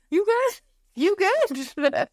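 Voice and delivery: high, squeaky concern; squeaky you good. Retold or imitated. Teen to crying aunt, high squeaky worried check. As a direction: ask if she's okay in a tiny squeak. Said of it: "I was like".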